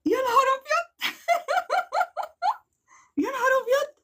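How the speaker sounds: high squealing disbelief. Real, not acted. Vlogger seeing Fifi won, squealing in disbelief. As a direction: squeal it, half laughing in shock. Stunned after drawing Fifi.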